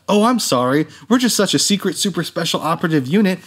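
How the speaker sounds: high, snide mock agent voice; mocking bullshit line. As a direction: send up the smug secret-agent line. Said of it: "he's like".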